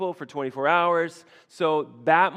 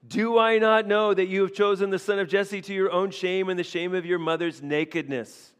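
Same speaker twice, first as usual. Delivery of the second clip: louder, higher scolding; angry rebuke; 5 semitones higher, 5 dB louder than usual. Acted. Saul berating Jonathan at the table, a louder angry read. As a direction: rage at his son. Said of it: "Saul's anger was kindled".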